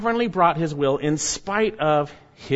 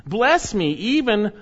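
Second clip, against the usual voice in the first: begging plea; 6 semitones higher than usual. Acted. Esau begging his father.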